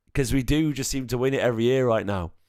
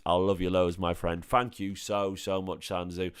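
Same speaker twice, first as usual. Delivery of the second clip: ordinary talk, nothing marked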